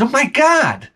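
high, delighted surprise; amazed. Retold or imitated. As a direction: marvel at the happy plants. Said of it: "I said".

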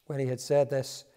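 ordinary talk

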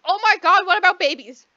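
high, loud hammed-up alarm; mock-panicked heroine shrieking. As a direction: squeal it like a sudden panic. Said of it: "she's like".

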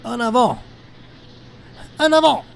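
shouted command. Real, not acted. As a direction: call it out to the sled dogs.

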